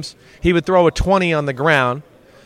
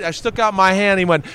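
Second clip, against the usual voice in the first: ordinary talk, nothing marked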